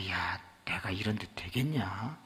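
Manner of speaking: soft, low whispered doubt; dejected whisper. Retold or imitated. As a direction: mumble it, beaten down. 풀죽은 집사. (풀죽어 작게 속삭이듯)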